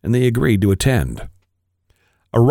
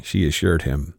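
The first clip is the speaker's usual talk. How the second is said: ordinary delivery, nothing marked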